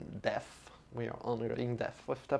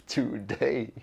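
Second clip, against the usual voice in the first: laughing. Shown as a demonstration.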